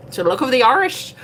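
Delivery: high, loud mock-Irish lilt; playful Irish accent. Streamer joking about luck, in a hammed-up Irish accent. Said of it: "where that accent came from".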